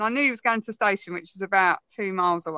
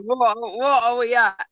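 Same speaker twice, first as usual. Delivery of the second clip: put-on baffled boyfriend; slower than usual. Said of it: "looking around, going".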